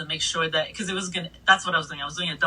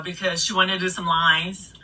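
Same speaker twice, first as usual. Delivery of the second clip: ordinary talk, nothing marked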